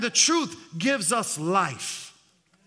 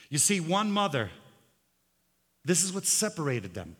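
ordinary talk

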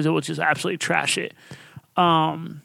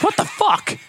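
shouted, much higher "what the fuck"; stunned disbelief; 14 semitones higher, slower than usual. Retold or imitated. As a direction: blurt it out in total disbelief. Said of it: "You're like".

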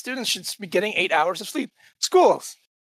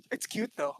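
normal talking voice

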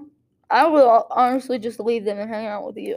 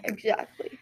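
whispering; 10 dB quieter than usual. Real, not acted. Playful back and forth, hushed.

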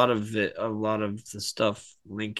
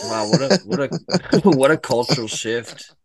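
laughing; 5 semitones higher, 10 dB louder than usual. Real, not acted. Laughing at absurd claim.

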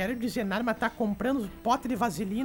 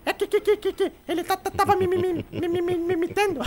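a second stammerer, a bit higher, tripping over que and me; mildly higher stutter, stuck on each word; stammering bar owner; 5 semitones higher than usual. Acted. The bar owner stammers to the police, tripping over his words. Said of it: "o cara era gago".

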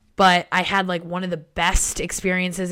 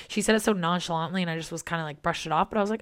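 normal talking voice

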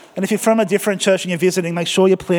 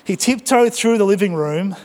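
normal talking voice

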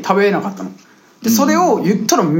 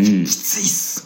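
imitating a groan; 10 semitones lower, 4 dB quieter, much slower than usual. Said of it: "みたいな".

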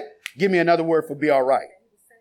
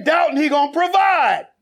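getting excited; 6 semitones higher than usual. Real, not acted. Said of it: "'cause I'm getting excited".

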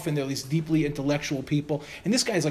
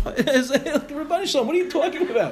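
high, exasperated cry to heaven; exasperated outcry; 9 semitones higher, 4 dB louder than usual. Retold or imitated. Answering the trucker's insult, exasperated outcry. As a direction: pitch it way up in comic disbelief.

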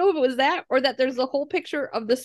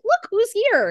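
higher-pitched, delighted announcement; excited little girl; 12 semitones higher, slower than usual. Retold or imitated. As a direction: show it off like a proud four-year-old.

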